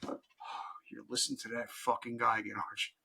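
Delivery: lightly voiced annoyed wife in bed. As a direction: mutter it like a fed-up wife. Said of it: "she'd be like".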